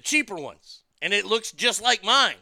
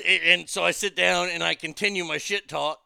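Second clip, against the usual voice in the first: normal talking voice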